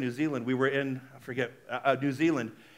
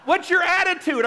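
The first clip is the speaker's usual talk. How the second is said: ordinary delivery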